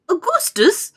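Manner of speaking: high, loud mother's call. A narrator's reading. Mother calls her son away from the river, calling out. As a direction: call sharply to your child. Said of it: "called out Missus Gloop".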